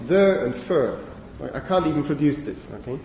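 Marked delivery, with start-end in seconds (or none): 0.1-1.0 "there" and "fur" both with the nurse vowel; same northern vowel. Shown as a demonstration. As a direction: make "there" rhyme exactly with "fur". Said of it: "you would use the same sound".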